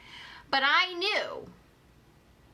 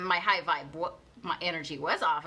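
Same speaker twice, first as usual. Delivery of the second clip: ordinary talk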